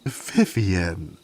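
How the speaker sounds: scandalized exclamation. Acted. Servant scolding the queen's crude stable joke, shocked. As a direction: protest her name, mock-scandalized.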